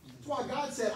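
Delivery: normal talking voice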